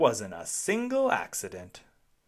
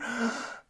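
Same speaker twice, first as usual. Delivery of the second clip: gasp; 11 semitones higher than usual. A narrator's reading. After the crash.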